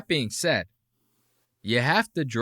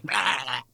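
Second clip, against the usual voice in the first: short imitated bear roar; Joe Rogan's bear impression. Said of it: "Joe Rogan does his bear impression".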